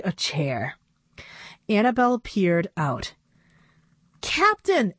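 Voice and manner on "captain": much higher, surprised cry of the name; startled exclaim. A narrator's reading. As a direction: caught off guard in the dark. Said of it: "exclaimed a startled voice".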